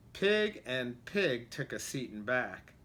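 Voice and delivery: plain reading voice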